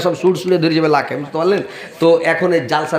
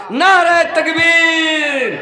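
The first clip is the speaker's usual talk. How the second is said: long drawn-out high shout of নারে তাকবীর; preacher's call; 10 semitones higher, much slower than usual. Acted. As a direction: call the crowd to answer, stretching every sound. Preacher calls the crowd. (ওয়াজের মঞ্চে ভিড়কে ডাক দিয়ে টেনে টেনে চিৎকার)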